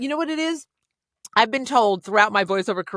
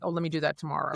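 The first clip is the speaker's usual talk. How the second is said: mumbled, softer put-off; procrastinating aside; 5 semitones lower, 9 dB quieter, faster than usual. Retold or imitated. Guest mimics someone putting things off, softer and lower. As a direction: toss it off quietly, lazy. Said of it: "you're like".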